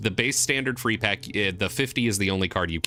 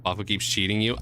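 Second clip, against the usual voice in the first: ordinary delivery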